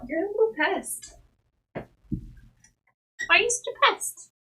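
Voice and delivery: high, slow teasing pet voice; high doting voice calling her a pest; mock scolding the cat. Real, not acted. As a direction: tease the cat sweetly.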